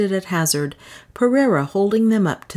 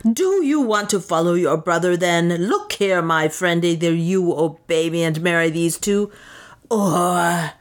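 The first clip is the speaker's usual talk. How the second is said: lightly voiced raspy threat; understated hoarse menace; hoarse with rage. A narrator's reading. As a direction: threaten him in contained fury. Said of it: "in a voice hoarse with rage".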